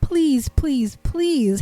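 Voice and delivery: pleading. Real, not acted. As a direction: beg them earnestly. Urgent pleading.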